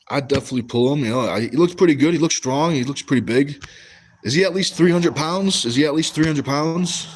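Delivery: ordinary talk, nothing marked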